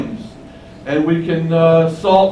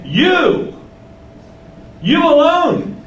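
"You, you alone" pitched much higher, each word held out; emphatic YOU; 12 semitones higher, much slower than usual. Acted. Preacher showing the Greek emphasis to listeners, "You" high and emphatic. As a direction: stress "You" hard with a high, drawn-out pitch, pausing before "you alone". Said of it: "which gives an emphasis".

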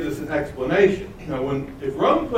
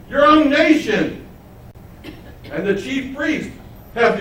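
mocking interrogation; 8 semitones higher than usual. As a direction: press him with scornful questions. Pilate interrogating Jesus.

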